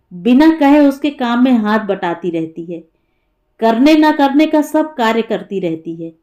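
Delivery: ordinary delivery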